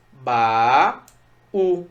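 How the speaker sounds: "Ba-u" with a long BA, a gap, then U; "Ba-u" dictated syllable by syllable; split into syllables. Shown as a demonstration. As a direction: pull "Ba-u" apart into two held beats. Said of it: "Como é que eu separo o baú?".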